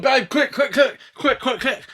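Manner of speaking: high, urgent tongue-tied babble; rapid-fire plea with a glued tongue; loud, panicky tongue-tied calling; tongue stuck to palate. Retold or imitated. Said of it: "Why are you talking like that".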